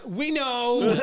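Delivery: high, loud exasperated retort; comic fed-up outburst; mock exasperation. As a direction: snap back at a judge stating the obvious. Acted retort to a judge.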